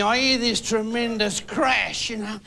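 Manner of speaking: ordinary delivery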